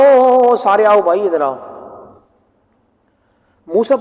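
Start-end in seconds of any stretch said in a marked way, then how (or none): 0.0-1.6 calling out to a crowd. Acted. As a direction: summon everyone over with a stretched-out holler. Acting out calling people together. (لوگوں کو آواز لگا کر بلاتے ہوئے)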